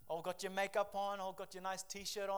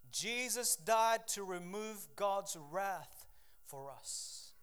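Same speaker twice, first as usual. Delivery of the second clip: normal talking voice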